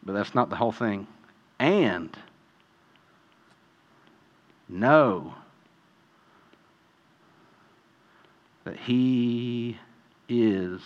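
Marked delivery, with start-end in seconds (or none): 1.6-10.8 much softer and lower, slow with long pauses; hushed emphasis. Real, not acted. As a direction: let each word land in a near hush. Quiet slow emphatic point.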